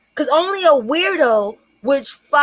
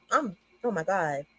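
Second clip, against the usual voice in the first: ordinary delivery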